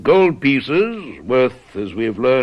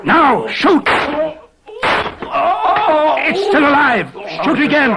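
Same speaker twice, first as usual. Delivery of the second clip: shouted orders; 13 semitones higher than usual. Acted. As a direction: yell the order to fire. Ordering shots at the intruder.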